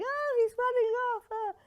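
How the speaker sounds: high, swooning wail; mock film wife. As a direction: overplay a dramatic abandoned wife. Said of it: "She's saying".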